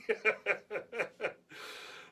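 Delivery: real, drawn-out giggling laugh; helpless run of chuckles; laughter. Real, not acted. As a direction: crack up at your own story. Cracking up at his own story.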